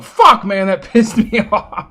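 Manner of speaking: high angry outburst with a laugh; loud sharp voice. Real, not acted. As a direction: vent loudly then laugh. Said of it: "Sorry for yelling so loud".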